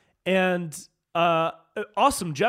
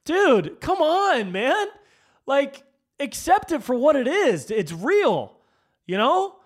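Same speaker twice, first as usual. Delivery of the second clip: louder and higher, fed-up pleading; exasperated rant; 9 semitones higher, 5 dB louder, slower than usual. Real, not acted. Fed up with partisans excusing their side, exasperated loud rant. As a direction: come on, man, with full frustration.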